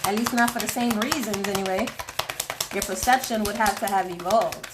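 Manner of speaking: usual speaking voice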